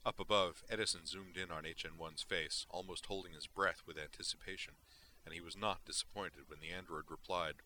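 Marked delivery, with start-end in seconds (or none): none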